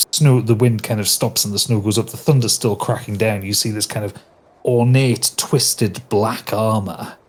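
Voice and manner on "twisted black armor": ordinary delivery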